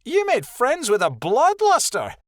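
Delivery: high, loud scornful question; rude disbelief. A narrator's reading. Rebecca shocked at Snapfoot's new friend, loud and rude. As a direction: blurt it out rudely, appalled. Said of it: "A rude voice broke the quiet".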